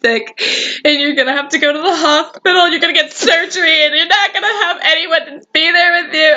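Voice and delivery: imitating mom. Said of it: "my mom was like".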